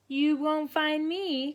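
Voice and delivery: a lightly voiced duckling teasing; duckling's cry. Duckling teasing Mama at hide and seek, lightly voiced. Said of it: "cried Whittle".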